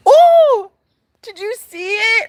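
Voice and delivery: sudden high squeal of surprise; a startled, delighted oh; surprised gasp. Real, not acted. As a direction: shriek of discovery. Sudden high exclaim at moth.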